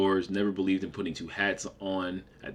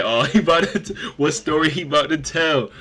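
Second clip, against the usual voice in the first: laughing, higher and louder mock friends; teasing friends voice; 8 semitones higher, 8 dB louder than usual. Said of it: "if y'all watch".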